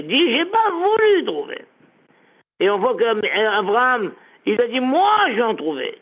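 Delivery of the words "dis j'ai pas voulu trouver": "voulu" slow, high and falling; slow emphatic "voulu"; slow stress on voulu. Shown as a demonstration. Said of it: "ne dis pas, j'ai pas pu trouver".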